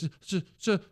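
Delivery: high, stammering mock sob; fake sobbing kid. Son fake-sobbing a made-up fish name, high and stammering. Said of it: "And he goes".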